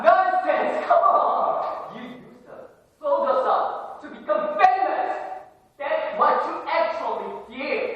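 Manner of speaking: high, loud, shouted rage; furious accusation. Acted. Student accusing the counselor of using them, furious shouting. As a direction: scream the betrayal at him.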